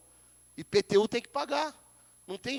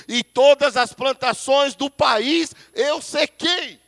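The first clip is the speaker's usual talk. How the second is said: booming divine voice, louder, building to a shout; God's thundering voice; 3 semitones higher, 8 dB louder than usual. Acted. Pastor voices God condemning the drought, building to a shout. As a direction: thunder it out as God punishing the land. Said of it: "Deus falando".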